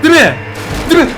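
high loud desperate question; desperate yell. Real, not acted. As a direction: shout it at the mummies. Desperate plea to mummies.

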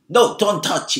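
loud, quick, stern order; sharp scolding to stop a child; sharp, forbidding tone. Shown as a demonstration. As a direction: snap it as a firm prohibition. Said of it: "날카롭고 어떤 금지하는 톤으로 얘기되어졌습니다".

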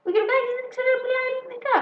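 quick, high mock retort. Imitating a listener's comeback, quick and high. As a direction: fire it back in a high teasing voice. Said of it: "θα μου πεις".

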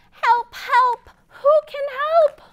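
high, loud little duck crying help; duck calling for help. Acted. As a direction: squeak out a panicky call for help. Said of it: "what does the duck say?".

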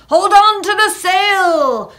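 high, loud shout over the storm; urgent cry. A narrator's reading. Jack warns crew battling a tropical gale, urgent shout. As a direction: yell to be heard in a gale. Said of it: "cried Jack as they battled".

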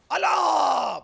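very loud, high drawn-out yell; shouted cheer. Real, not acted. Gamer calling out as a goal comes, shouted cheer. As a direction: belt it out across the game. Calls out during play.

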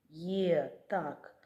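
ordinary delivery

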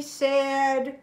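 usual speaking voice

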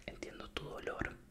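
normal talking voice

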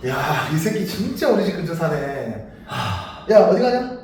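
ordinary delivery

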